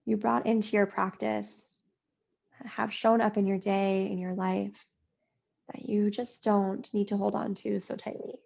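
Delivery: usual speaking voice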